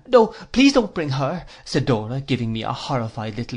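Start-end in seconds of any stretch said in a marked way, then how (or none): 0.1-1.5 put-on high, louder childish plea; pleading. Dora begs him not to bring his aunt, high pleading. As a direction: beg in a much higher voice.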